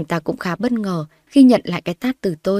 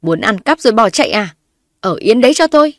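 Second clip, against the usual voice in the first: mother-in-law yells to block her leaving; yelling; 3 semitones higher, 7 dB louder than usual. A narrator's reading. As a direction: shout her down to stop her. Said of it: "mà la lên".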